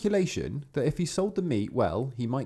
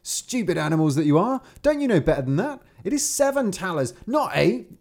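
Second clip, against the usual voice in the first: higher and louder scolding peasant; exasperated shout at frogs; 8 semitones higher, 6 dB louder than usual. Acted. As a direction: yell at the stupid frogs. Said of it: "he cried to them".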